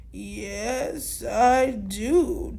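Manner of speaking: low, slow and unhurried; Tortoise answering Fiona slowly; slow old tortoise. Acted. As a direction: take your time like an ancient tortoise. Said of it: "Tortoise said slowly".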